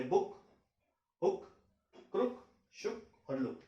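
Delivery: short quick u in बुक and लुक. Shown as a demonstration. Teacher shows short quick oo sound. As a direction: clip the u of हुक and क्रुक. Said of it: "तो उसे जल्दी बोलना होता है".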